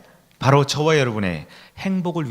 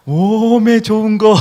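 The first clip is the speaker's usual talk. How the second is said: slow, higher Jeolla 오메 좋은 거; Jeolla dialect; 6 semitones higher, 7 dB louder, slower than usual. Retold or imitated. 전라도 사람 흉내를 내는 목사, 느리고 높은 전라도 사투리. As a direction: draw it out like a delighted Jeolla elder. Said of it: "전라도 분들은".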